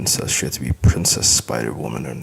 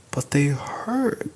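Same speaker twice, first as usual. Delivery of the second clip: high, slow whispered whine; whining girl; 11 semitones higher, much slower than usual. A narrator's reading. Daughter complaining about her teeth, high whine. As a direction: whimper it like a small child. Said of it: "she whines".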